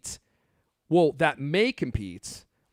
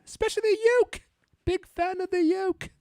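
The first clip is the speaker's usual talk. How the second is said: Missus D voice; 4 dB louder than usual. Acted. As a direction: coo it like a prim little old British lady, much higher. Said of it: "What a wonderful British accent Missus D has".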